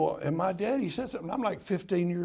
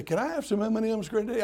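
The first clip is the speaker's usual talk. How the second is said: put-on child asking; 6 semitones higher, faster than usual. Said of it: "they say".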